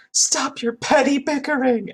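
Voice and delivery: high-pitched mock scolding; imitated snapping. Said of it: "she specifically says".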